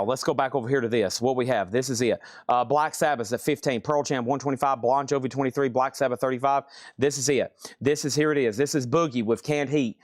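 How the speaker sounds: usual speaking voice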